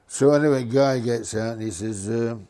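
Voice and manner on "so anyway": usual speaking voice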